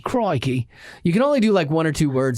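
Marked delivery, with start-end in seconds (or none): 0.0-0.7 Australian crikey. Retold or imitated. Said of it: "crikey is another good".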